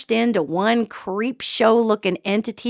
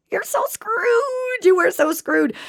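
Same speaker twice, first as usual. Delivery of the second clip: wailed warning; 10 semitones higher than usual. Real, not acted. Warning anyone who loses control over fear, wailed warning. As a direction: stretch it out high like a mock lament.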